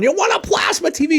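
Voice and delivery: high, put-on voice of a phone caller; mock caller voice. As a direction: pitch way up like a goofy stranger on the line. Prank call offering a TV.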